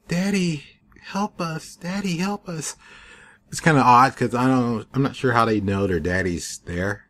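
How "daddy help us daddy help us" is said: high, soft mock children calling for daddy; imitating screaming kids. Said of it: "you can hear the kids in the background screaming".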